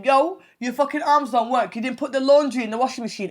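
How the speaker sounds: loud mock scolding; telling off. Wife to husband over undone laundry, telling him off.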